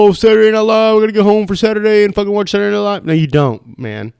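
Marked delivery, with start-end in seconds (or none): 0.1-3.1 higher, louder, fast eager fan voice; mocking a hyped-up viewer; mock SNL fan. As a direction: parody a gushing fan planning his night in. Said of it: "I know you don't fucking go".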